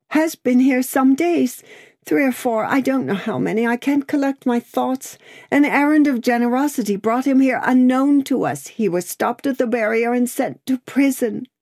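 high, agitated outpouring; distraught. A narrator's reading. Lucy telling Lorry of her husband's arrest, distraught. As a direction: blurt the news out, pitched high and urgent.

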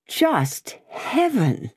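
high, drawn-out cry of dismay; shocked exclamation. A narrator's reading. Prisoner hears he is consigned to prison, shocked exclamation. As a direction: exclaim it slowly, pitched well up. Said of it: "exclaimed Darnay".

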